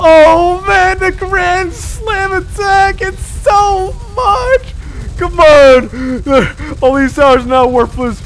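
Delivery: high, loud dismayed shouting; excited shouting. Real, not acted. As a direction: cry out as everything falls. Overwhelmed by boss attacks.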